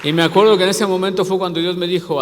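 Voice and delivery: loud, building preacher's cry; preaching. Acted. Pastor rousing the congregation, loud and building. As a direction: lift the church with the climax. Climax of testimony, preaching again.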